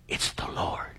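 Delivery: hushed, breathless whisper; awed whisper. Acted. John recognizing Jesus on the shore, awed whisper. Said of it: "John the Beloved said".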